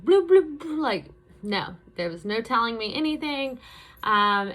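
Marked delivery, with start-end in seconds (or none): 0.1-0.8 higher, louder silly bloop noises; mock dismissive babble. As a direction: brush it all off with a goofy bloop. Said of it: "I was just like".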